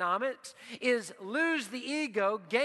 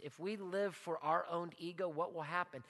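ordinary talk, nothing marked

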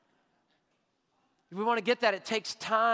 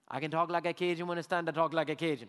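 Cajun accent; 4 semitones lower than usual. Shown as a demonstration. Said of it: "my Cajun accent".

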